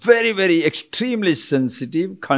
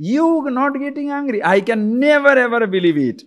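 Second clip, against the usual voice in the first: teasing disbelief; 10 semitones higher than usual. Acted. Doubter provoking a man who denies anger, teasingly incredulous. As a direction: needle them with exaggerated doubt.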